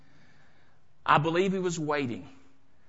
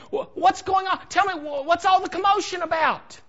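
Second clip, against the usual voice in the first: high, urgent acted questioning; agitated questioning; 11 semitones higher than usual. Blind beggar stops somebody in the crowd, agitated questioning. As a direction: stammer it out, pitched high and eager to know. Acts blind Bartimaeus stopping a passer-by.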